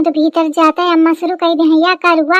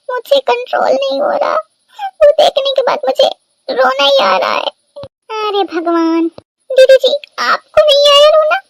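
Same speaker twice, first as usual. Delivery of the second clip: mock crying; 5 semitones higher than usual. Woman weeping after emotional film.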